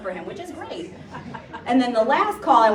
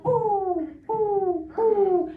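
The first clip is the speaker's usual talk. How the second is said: mourning dove coo; 10 semitones higher, much slower than usual. Retold or imitated. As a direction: coo them gently like a dove. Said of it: "But it says".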